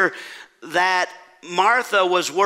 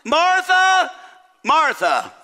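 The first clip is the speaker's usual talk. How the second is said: double-dinged name; 10 semitones higher, 4 dB louder, slower than usual. Acted. Jesus answers Martha's complaint, double-dinging her name. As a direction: call the name twice, first pitched way up, then dropping. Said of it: "Notice Jesus double dinged her".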